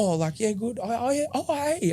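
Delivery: high, halting mock recognition; imitating someone caught off guard; awkward greeting. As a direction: stumble through a reluctant hello.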